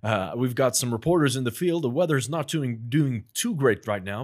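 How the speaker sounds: news anchor. Acted. Guest improvises a bulletin at host's request, news anchor voice. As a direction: announce it like a live channel news bulletin. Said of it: "let's hear news anchor".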